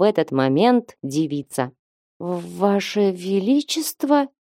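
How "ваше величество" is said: slow, faltering, disbelieving bleat; timid bleat. A narrator's reading. Trader recognizing the king in the woods, timid slow bleat. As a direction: bleat it out haltingly, unsure of yourself. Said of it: "проблеял Водрок".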